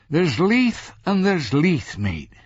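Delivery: high, slow cabbie spelling it out; driver explaining. A narrator's reading. Driver explains Leith to his passenger, pointedly. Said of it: "he explained".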